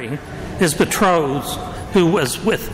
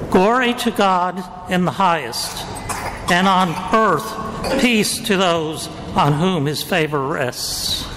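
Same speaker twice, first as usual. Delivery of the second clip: Gospel reading. Acted. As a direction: proclaim it like a liturgical reading.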